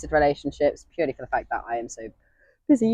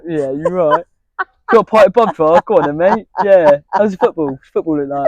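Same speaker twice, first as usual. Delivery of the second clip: louder, blokey pub lad; 2 semitones higher, 6 dB louder than usual. Retold or imitated. Imitating himself chatting to a mate at the pub, louder and blokey. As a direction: play the lad at the bar. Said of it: "at the pub, I'm like".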